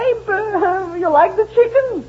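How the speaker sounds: high sweet wifely voice; a doting wife fishing for praise; wife's voice. Acted. Wife asks husband about dinner, high sweet voice.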